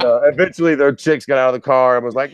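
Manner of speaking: ordinary talk, nothing marked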